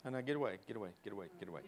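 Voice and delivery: soft, low, ineffectual "get away"; mild repeated brush-off, barely voiced; half-hearted shooing. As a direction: shoo a dog without any force. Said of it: "The dog pays no attention to you".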